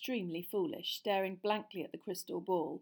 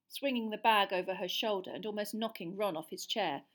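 ordinary delivery, nothing marked

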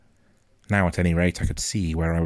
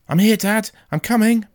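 high, loud eager answer; calling out; 7 dB louder than usual. A narrator's reading. Boy answering his father as he runs, calling out. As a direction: shout back across the distance.

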